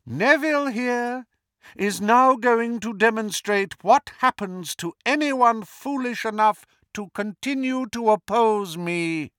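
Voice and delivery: high, menacing villain announcement; Voldemort. A narrator's reading. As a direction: announce the punishment as a warning to all. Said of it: "said Voldemort".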